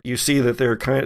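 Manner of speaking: ordinary talk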